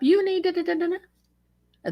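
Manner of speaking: high-pitched mock nagging, trailing into babble; mocking mimicry. Woman mimicking the man pestering police, high mocking whine. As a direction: imitate a pest going on and on, way up high.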